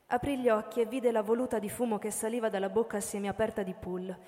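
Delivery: normal talking voice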